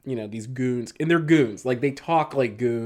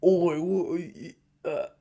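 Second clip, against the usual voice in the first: slow, higher mock New England goon accent; 6 semitones higher, slower than usual. Podcaster imitates the game's actual henchmen, mild New England accent. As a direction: lightly imitate a Boston-area henchman. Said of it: "they sound like, like, New England goons".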